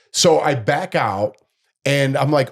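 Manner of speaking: ordinary talk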